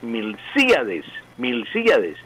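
slow Milcíades with the í stressed; Milcíades spelled out, hiatus in í-a; correct pronunciation. Shown as a demonstration. Professor stating the correct form, slow. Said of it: "la pronunciación correcta".

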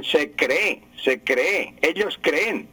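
cree and creen wrongly stressed on the final E; acento agudo erróneo. Retold or imitated. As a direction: mimic the error: se creÉ, ellos creÉN. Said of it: "hay que acentuar la última E, que es aguda". (cree y creen con la última e acentuada)

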